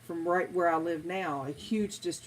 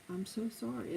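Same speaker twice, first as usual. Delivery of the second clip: softer, soothing apology; softly apologetic; 4 semitones higher, 8 dB quieter than usual. Shown as a demonstration. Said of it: "you keep lowering your voice".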